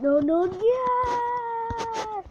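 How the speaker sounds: high loud victory shout; triumphant cheer. Real, not acted. Gamer cheering a hard jump, triumphant. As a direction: explode with joy.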